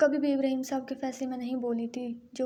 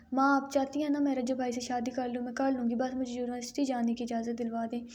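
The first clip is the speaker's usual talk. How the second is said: usual speaking voice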